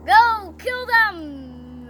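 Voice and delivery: loud, drawn-out hammed-up command; mock execution order. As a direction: play a king hollering an order, very slowly.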